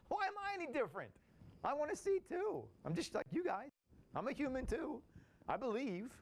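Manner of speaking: high, whiny plea to be included; pleading Thomas. Retold or imitated. Thomas pleading with the apostles, high and whiny. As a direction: beg the others not to leave you out.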